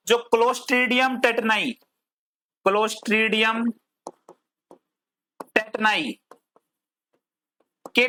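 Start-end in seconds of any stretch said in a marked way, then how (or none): 2.7-6.1 drawn-out word by word, long pauses; slow dictation. Shown as a demonstration. Teacher spelling out a bacterium name for students, slow dictation. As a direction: dictate it slowly, pausing before the second word.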